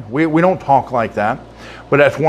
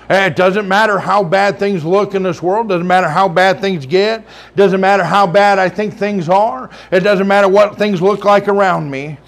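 raised, ringing preacher's cadence, higher; full-force preaching; 5 semitones higher than usual. Acted. Pastor preaching to his church, full-force and ringing. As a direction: preach it out to the congregation.

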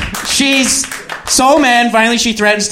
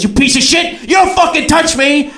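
high, explosive outburst; mock furious shout; 9 semitones higher than usual. Woman slapping his hand away, high mock furious yell. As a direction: imitate someone recoiling in outrage.

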